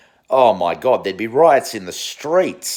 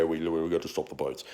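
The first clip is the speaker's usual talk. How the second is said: lightly voiced, much softer lazy politician; mock dismissive slogan; 7 semitones lower, 10 dB quieter, faster than usual. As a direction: toss off the slogan half-heartedly. Mocking Liberal slogan.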